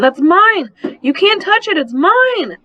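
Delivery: put-on child screaming. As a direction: scream it like a possessive toddler. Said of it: "Angelina screamed".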